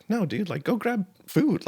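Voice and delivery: acted high-pitched insistence; insistent urging. Urging hungry man to take food.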